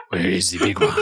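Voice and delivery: low mock French, laughing; mimicked French accent. Game master repeating the line, mock French accent. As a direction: echo the French knight through laughter.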